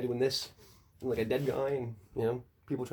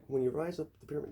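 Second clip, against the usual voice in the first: usual speaking voice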